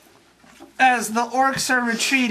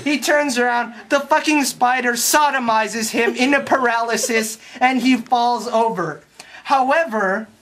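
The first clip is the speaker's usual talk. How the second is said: normal talking voice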